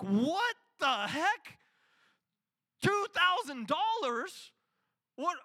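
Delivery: high, loud and slow disbelief; shocked outrage. Retold or imitated. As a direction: blurt it out at the bill in shock. Scott sees credit card bill.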